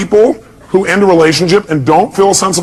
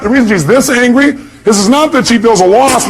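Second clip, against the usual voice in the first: ordinary delivery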